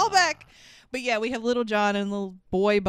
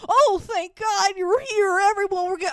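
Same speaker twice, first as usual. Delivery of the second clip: loud mock Little John; 9 semitones higher, 4 dB louder than usual. Retelling the movie, mocks Little John's loud rescue. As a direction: ham up a bellowing Little John. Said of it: "he also is loud as fuck about it".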